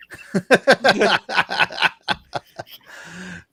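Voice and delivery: long burst of laughter. Real, not acted. Host cracks up at his own accent, long laugh.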